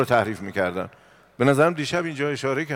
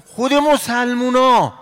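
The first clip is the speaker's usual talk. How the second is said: much higher, louder and slowed outcry; indignant exclamation; 11 semitones higher, 10 dB louder, much slower than usual. Acted. Preacher revealing the attackers were Muslims, loud outcry. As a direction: cry it out in disbelief.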